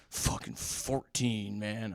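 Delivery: slow, unimpressed, lightly voiced; bored cop voice. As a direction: drag it out like a weary cop. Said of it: "the most bored suburban cop in the whole world".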